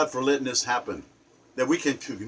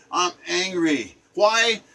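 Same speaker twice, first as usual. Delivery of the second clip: normal talking voice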